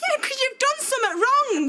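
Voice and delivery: high-pitched, heated retort; angry accusation. Acted. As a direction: throw the blame straight back. Daughter flings accusation at mother.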